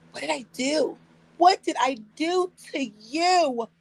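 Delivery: slow, high, bewildered Karen act. Pretend. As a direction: play the confused innocent Karen. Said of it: "Get my best Karen on".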